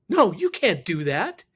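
loud, high, drawn-out protest; alarmed objection. Retold or imitated. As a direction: imitate her urgently stopping them. Retelling her alarmed objection.

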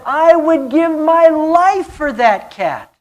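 mock earnest cat lady; woman's voice. Pastor voices woman's pleading quote.

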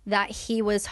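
usual speaking voice

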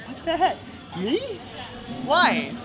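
high startled what the heck; sudden squeak of surprise; surprised. Real, not acted. Surprised at being singled out.

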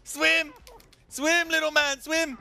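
high, loud urging shouts; excited cheering. Real, not acted. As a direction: cheer them on to swim. Cheering falling character on.

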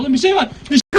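sudden frightened shout; panicked shout. Real, not acted. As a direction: yell out in fright. Sees something scary in dark. (korkuyla bağırma)

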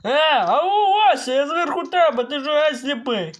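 loud, high call to someone below. Acted. Gamer yelling at villager Vasya from above. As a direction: shout up to get his attention.

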